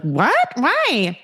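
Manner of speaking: high-pitched squeal of dismay; mimicked whiny protest. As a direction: squeak it in mock disbelief.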